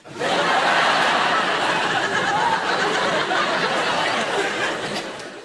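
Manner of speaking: high, unvoiced nervous giggle; nervous laugh. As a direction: giggle weakly to avoid confrontation. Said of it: "I went".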